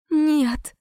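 high-pitched, weepy refusal; tearful reply. A narrator's reading. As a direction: answer helplessly through tears. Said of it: "сквозь ручей слёз ответила я".

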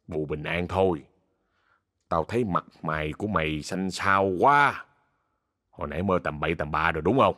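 put-on low-pitched older driver's voice; low, offhand voice advising a young passenger; bus driver. A narrator's reading. Gruff driver advises young man. (giọng bác tài trầm thấp)

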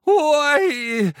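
high drawn-out wailing ой; mournful howl. Acted. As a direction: howl in misery. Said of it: "послышался заунывный вой".

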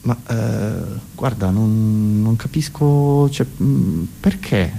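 slow, halting puzzlement; baffled friend. Acted. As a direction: stall and hesitate, unsure what to say. Friend baffled by Fontana's idea.

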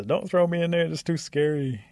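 high, put-on wailing plea; mock howling and crying. A narrator's reading. Rabbit begging not to be tossed in bushes, fake crying. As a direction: plead in a lightly hammed-up wail. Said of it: "rabbit starts to howl and cry".

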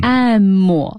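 long "M" ending in an added "mo"; some teachers' M. Shown as a demonstration. Host repeating the questioned reading, M with a tail vowel. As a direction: stretch "M" and let a vowel follow. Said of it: "有的老师会念成".

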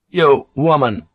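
rough, peremptory address. A narrator's reading. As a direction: call out roughly to a woman in the street. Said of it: "a young samurai said roughly".

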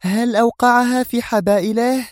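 very high, indignant female voice. A narrator's reading. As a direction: ask with scornful outrage, pitched high. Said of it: "هتفت في سخط".

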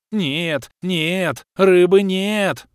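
high, loud, slow frantic squeal; shrill panicked creature wail, high and loud; wild wailing creature. Acted. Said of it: "Дико заблажил Лохр".

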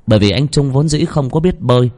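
ordinary delivery

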